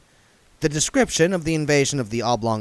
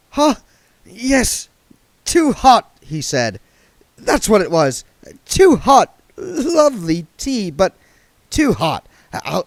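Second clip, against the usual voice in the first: flustered; 10 semitones higher, 6 dB louder than usual. A narrator's reading. William flustered after spitting.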